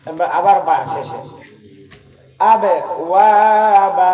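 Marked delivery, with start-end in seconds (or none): 2.4-4.1 আবে, ওয়াবা slow and louder, ওয়াবা stretched; louder slow example. Shown as a demonstration. Teacher to class: example said slowly, louder. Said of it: "জজম দিয়ে একটা মিসাল বল".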